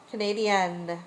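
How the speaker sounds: ordinary talk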